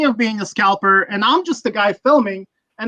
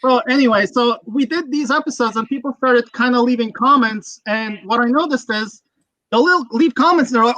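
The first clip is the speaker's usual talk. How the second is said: ordinary talk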